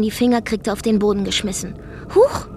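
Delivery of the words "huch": short, high-pitched exclamation; startled oops. Acted. Woman throwing things to the floor, startled oops. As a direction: pop out a high little cry of surprise. Mock surprise while smashing things.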